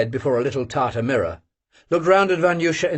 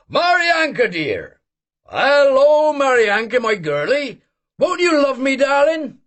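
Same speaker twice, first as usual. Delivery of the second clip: high, loud bellow out the window; 13 semitones higher, 4 dB louder, slower than usual. Acted. Old man bellows out the window at the passing girl, loud and high. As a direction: holler to the girl like a jolly old wag. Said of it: "and bellowed".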